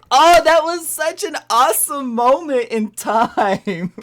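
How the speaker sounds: high, slow, laughing delight. Real, not acted. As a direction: gush about it through laughter. Delighted recalling the moment.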